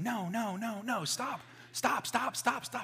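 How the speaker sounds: high, repeated pleas to stop; mock-panicked string of objections; helpless protest. Believers fending off the devil, high helpless protest.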